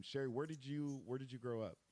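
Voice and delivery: ordinary talk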